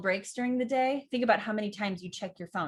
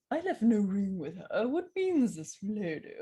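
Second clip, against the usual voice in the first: a stiff English butler. Shown as a demonstration. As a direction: say it like a prim butler. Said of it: "archetypes like butler".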